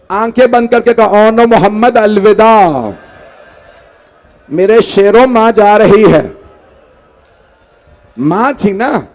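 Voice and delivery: chanted, grieving storyteller's cadence; mourning recitation. Acted. Preacher recites the martyrdom to the gathering, in a mourning chant. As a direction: recite the tragedy to move the mourners. Majlis narration continues.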